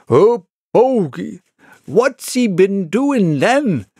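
high, slow, exclaiming villager; vociferous eyewitness. A narrator's reading. Villager in the crowd reacting to the bogey, vociferous. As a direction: voice an agitated local gawker. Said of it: "evidence of a vociferous eyewitness".

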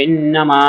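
long MAA at the end of ইন্না মা; টেনে পড়া. Shown as a demonstration. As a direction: hum the N of ইন্না, then stretch মা. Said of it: "মাটা তিলে টেনে পড়তে হবে". (ইন্না মা-র মা টেনে পড়া)

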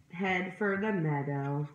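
plain reading voice

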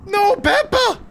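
high pained cry of the name; anguished shout. Real, not acted. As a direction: yell in dismay as she dies. Character killed in game.

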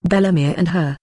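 ordinary delivery, nothing marked

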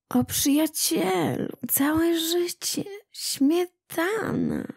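high, slow, breathy cat swooning over cream; dreamy cat. A narrator's reading. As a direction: whisper it like a cat in rapture.

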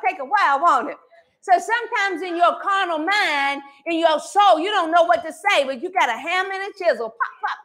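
usual speaking voice

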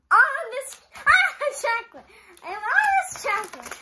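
high and loud, laughing excitement; giddy excitement. Real, not acted. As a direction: squeal over all the chocolate. Overwhelmed by pile of chocolate.

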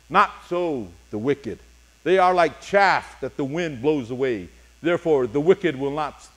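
usual speaking voice

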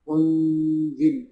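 أنزل with the ن hidden in a held nasal hum; nasal ikhfa. Shown as a demonstration. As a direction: hum the ن of أنزل through the nose, slowly. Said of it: "في حكم بنسميه إخفاء".

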